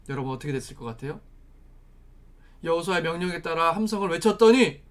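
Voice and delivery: usual speaking voice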